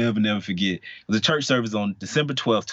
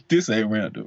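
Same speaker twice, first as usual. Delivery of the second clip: laughing. Real, not acted. Joking about himself, laughing.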